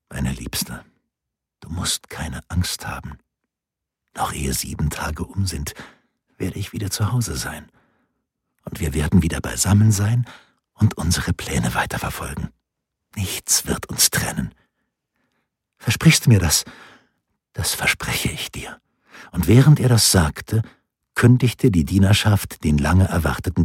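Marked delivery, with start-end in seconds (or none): 0.1-16.6 soft, tender reassurance. A narrator's reading. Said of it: "mit aller Zärtlichkeit". 17.5-18.8 whispered promise. A narrator's reading. Quiet promise to her husband.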